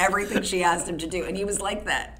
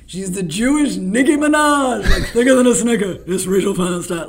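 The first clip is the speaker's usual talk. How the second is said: imitating Shaq announcing; 3 semitones higher, 10 dB louder than usual. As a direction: hype her up like a ring announcer, laughing. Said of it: "he would be like".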